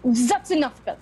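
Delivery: loud, sharp cutoff; stern mother silencing daughter; sharp rebuke. Acted. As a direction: snap the order to stop. Grandmother cuts off Bella sharply.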